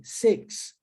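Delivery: "six" said as "seks" with an open e; "six" como "seks"; six with e sound. Shown as a demonstration. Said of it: "Olha a diferença".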